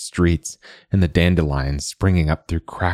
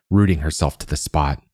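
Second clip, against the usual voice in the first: ordinary delivery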